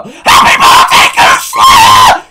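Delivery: very high, loud squeaky cartoon shout; high squealing birthday shout; Stampy voice. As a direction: scream it like a hyper kids' gamer. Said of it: "I'll do it in the Stampy voice".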